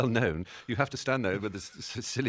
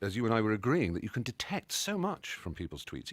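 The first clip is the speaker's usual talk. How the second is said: ordinary talk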